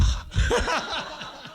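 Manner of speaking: high burst of laughter; a delighted high giggle; laughing. Real, not acted. Preacher laughing at a lovely line.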